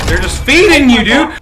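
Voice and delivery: laughing shout. Real, not acted. Laughing at teammate's luck.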